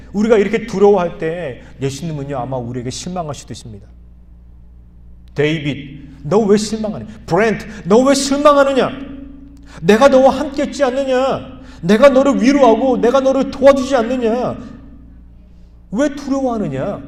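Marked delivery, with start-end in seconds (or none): 5.3-17.0 loud, higher pleading call; Jesus calling out. Acted. Jesus calling to worried believers by name, loud and pleading. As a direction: call each name out with urgent love. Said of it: "예수님, 이렇게 말씀하고 있다는 것이죠".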